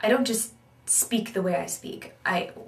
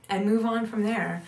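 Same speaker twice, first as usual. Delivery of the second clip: ordinary talk, nothing marked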